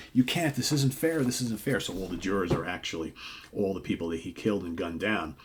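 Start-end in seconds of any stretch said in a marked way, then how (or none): 0.1-1.8 high-pitched, pleading complaint, lightly acted; mock desperate protest. Killer protests trial by his twelve victims, mock desperate protest. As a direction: plead helplessly, as a retold character.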